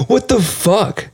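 high, drawn-out disbelief; incredulous. Real, not acted. Reacting to the book title, high incredulous drawl. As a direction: stretch it out high in bafflement.

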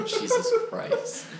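laughing through the words; drawn out, cracking up. Real, not acted. Bursts out laughing at vaping.

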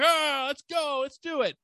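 high-pitched mock team cheer. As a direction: play a whole squad whooping to go. Cops cheer their chief's order.

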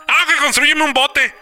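pestering little brother. Retold or imitated. Teller imitating his brother insisting on a boat, whiny pestering. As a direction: imitate a kid who keeps begging.

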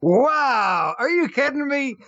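high disbelieving wow; stunned, high-pitched disbelief; incredulous. Real, not acted. Reacting to the clip.

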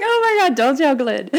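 playful mock alarm, laughing; laughing mock panic. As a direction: squeal it, caught out and laughing. Laughing panic at biggest-customer news.

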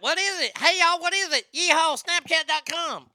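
high mock imitation of a woman running in; imitating her. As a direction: play her as clueless and twangy, asking what's going on. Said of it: "However she sounds".

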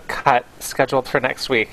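ordinary talk

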